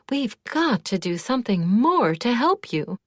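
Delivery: fussing anxiously, a little breathless in tone; in a tizzy. A narrator's reading. Worried friend rushing to offer help, flustered. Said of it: "Glad said in a tizzy".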